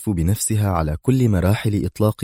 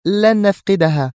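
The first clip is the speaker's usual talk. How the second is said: high, sharp denial; high-voiced heated retort; 12 semitones higher, 4 dB louder than usual. A narrator's reading. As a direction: cut back sharply, high and forceful. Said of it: "قائلة في حدة".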